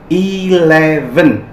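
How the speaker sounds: Eleven stretched slowly, clear v sound; slow model pronunciation. Shown as a demonstration. Teacher stresses the v in Eleven, slowly. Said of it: "ingat itu V".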